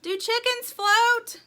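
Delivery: high, loud, drawn-out question; slow panicky shout as water climbs; yelling. A narrator's reading. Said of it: "yelled Poppy".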